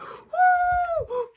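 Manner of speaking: high, loud wailing; hammed-up crying howl; mock crying. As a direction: bawl like an upset child. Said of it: "crying like".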